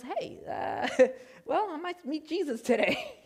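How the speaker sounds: nervous half-laugh through the words; inner voice laughing. Retold or imitated. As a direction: laugh off a dark thought. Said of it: "a little doubt in your mind that goes".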